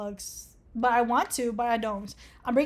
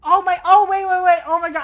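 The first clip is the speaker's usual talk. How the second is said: loud, high burst of sudden realization; startled realization; 7 semitones higher, 13 dB louder than usual. Real, not acted. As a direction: blurt it out, catching yourself mid-read. Sudden realization while reading the quiz.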